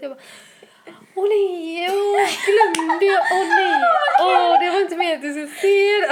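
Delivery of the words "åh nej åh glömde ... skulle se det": high, loud mock-panicked wail; hammed-up dismay, half laughing; faked panic. Woman pranking boyfriend with pregnancy test, faked panic. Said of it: "fejkar vara så här livrädd".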